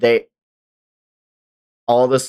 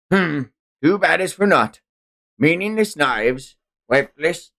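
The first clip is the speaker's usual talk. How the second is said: sneering pirate captain, raised pitch; slaver captain; 2 semitones higher than usual. Acted. Streamer reading manga as the slaver captain, gloating.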